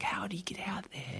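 hushed, wondering whisper; awed whisper. Retold or imitated. Zoo-born cheetah hearing of the savannah, in an awed whisper. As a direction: breathe it like a secret.

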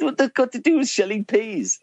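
high, squeaky disbelief in jest; mock incredulous. Patient puzzling over the surgeon's joke, high mock disbelief. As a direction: pitch it way up, playfully baffled. Incredulous thought before anaesthesia.

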